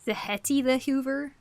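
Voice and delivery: ordinary talk, nothing marked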